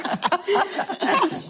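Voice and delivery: high, breathless laugh fighting back tears; teary laugh. Real, not acted. Mother overcome, laughing after host teases her. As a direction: laugh it off through the emotion. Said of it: "'Cause you're on the table".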